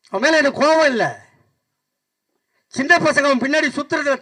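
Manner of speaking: high, forceful rally challenge; fiery political oratory. Acted. Speaker challenging Vijay's absence during disasters, fiery oratory. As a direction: hurl each question out in high, ringing phrases.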